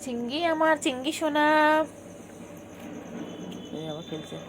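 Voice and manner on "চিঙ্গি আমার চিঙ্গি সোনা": high pet-directed coo. Real, not acted. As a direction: coo sweetly to your little pet. Cooing to her pet cat.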